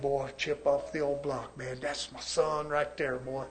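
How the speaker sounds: low, soft proud-dad murmur; hushed, low imitation of fatherly pride. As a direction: imitate a dad quietly admiring his boy. Voices father proud of son.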